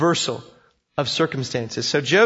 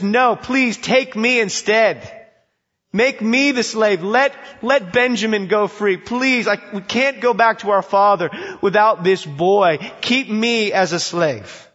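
slow, higher, earnest begging; pleading; 5 semitones higher, 4 dB louder than usual. Acted. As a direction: beg to be taken instead. Judah begging at Joseph's feet.

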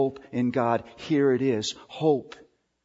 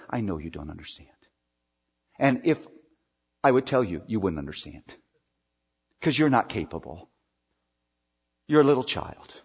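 tender reassurance; 6 semitones lower than usual. Acted. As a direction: comfort a frightened child gently. God speaks to listener tenderly.

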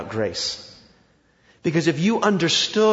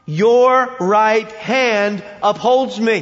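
"Your right hand upholds me" read slowly and louder, each word weighted; emphatic, slow reading; 3 semitones higher, 6 dB louder, much slower than usual. Shown as a demonstration. Preacher stressing the verse for the congregation, slow and emphatic. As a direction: lean on every word of the verse. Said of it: "Do you see that emphasis there?".

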